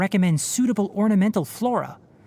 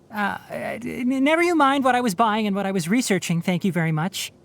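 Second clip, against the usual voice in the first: stammer, then higher-pitched brush-off; flustered deflection; 3 semitones higher than usual. Acted. Embarrassed researcher dodging questions about purchases, flustered. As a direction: trip over the start, then hurry to deflect. Caught out over secret purchases.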